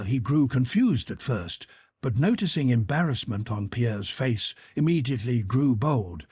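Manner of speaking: ordinary delivery, nothing marked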